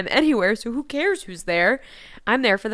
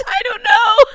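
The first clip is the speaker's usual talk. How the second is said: high, louder teary laughter; laugh-crying; 12 semitones higher, 9 dB louder, slower than usual. Real, not acted. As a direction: squeal it out laughing.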